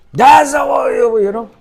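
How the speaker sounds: high, loud burst of a yell; yelling father. Acted. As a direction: snap loudly like a father losing control. Acting out an out-of-control father yelling.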